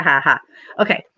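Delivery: ordinary talk, nothing marked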